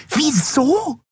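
very high, indignant echo; shrill squeak of disbelief. A narrator's reading. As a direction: squeal the question back. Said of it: "wiederholte die Jadi schrill".

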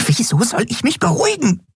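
high, agitated squeak; worked-up, very high voice; hysterical. A narrator's reading. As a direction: protest in a frantic high pitch. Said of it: "Wär doch nicht gleich hysterisch".

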